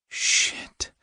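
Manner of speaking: breathy whispered swear under the breath; whispered curse. Acted. Driver realizing the wallet is missing, whispered curse. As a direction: hiss it to yourself, half-voiced.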